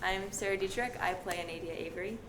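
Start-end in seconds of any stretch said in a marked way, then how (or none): none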